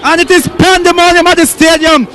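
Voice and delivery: high, lively live commentary; excited play-by-play. Real, not acted. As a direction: call the celebration live.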